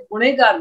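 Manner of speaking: ਗੁਨੇਗਾਰ with "ne" for "nah"; misreading to avoid. Shown as a demonstration. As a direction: leave out the h in ਗੁਨੇਗਾਰ. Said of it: "ਗੁਨੇਗਾਰ ਨਹੀਂ ਪੜ੍ਹਨਾ".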